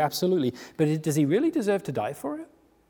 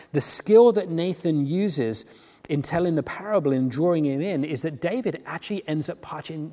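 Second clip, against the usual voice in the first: ordinary talk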